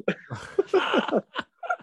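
a high burst of laughter. Real, not acted. Laughing at own story.

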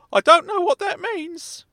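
kindly meddling uncle, high and loud; Uncle Claude voice. Acted. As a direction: pitch way up as a fussy elderly uncle.

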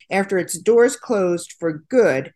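ordinary talk

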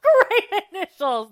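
high, hammed-up salesman praise; mock gushing. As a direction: laugh through it, stretching the compliment. Repeats Seth's gushing compliment.

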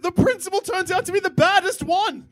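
high, excited, laughing; excited laughing rant. Real, not acted. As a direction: spill it out high and giddy. Excited rant about guns.

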